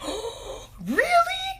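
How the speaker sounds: a squeal that jumps very high; thrilled. Retold or imitated. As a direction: gush like big news just landed. Retelling her own thrilled reaction.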